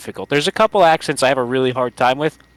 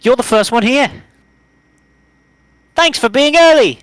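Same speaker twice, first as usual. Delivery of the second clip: much higher and louder sweet schoolgirl voice; high-pitched Monika; 7 semitones higher, 5 dB louder than usual. Acted. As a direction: pitch the girl's line way up. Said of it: "when I high pitch them".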